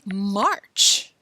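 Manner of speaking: ordinary delivery, nothing marked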